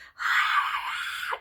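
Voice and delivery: imitating a Satan-like bellow; hint of a demonic roar; mock bellowing cry. Showing her husband her crying rage, a bellow. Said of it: "deep bellowing voice as if Satan".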